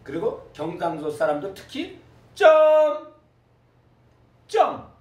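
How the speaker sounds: 점 said with a sharp high Gyeongsang tone; Gyeongsang pitch tones. Shown as a demonstration. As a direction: jump the pitch way up on the second 점. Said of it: "성조에 따라서 다 달랐잖아".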